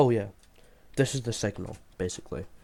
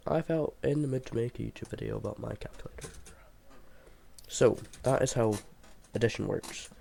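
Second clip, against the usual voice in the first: usual speaking voice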